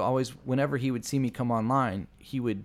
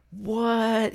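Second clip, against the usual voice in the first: high, drawn-out retold "what?" of disbelief; disbelieving; 11 semitones higher, 4 dB louder than usual. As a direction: mimic a stunned friend, pitched way up. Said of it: "He couldn't believe".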